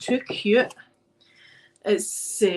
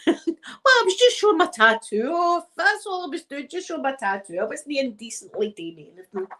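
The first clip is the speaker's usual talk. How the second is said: mock innocent protest; 6 semitones higher than usual. Mock protesting innocence after laughing.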